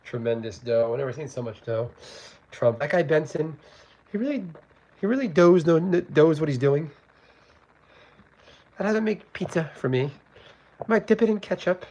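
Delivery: lightly voiced Trump parody. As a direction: poke fun with a light Trump impression. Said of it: "He's just fun to make fun of".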